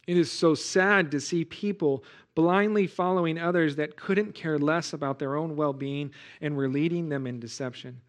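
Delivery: usual speaking voice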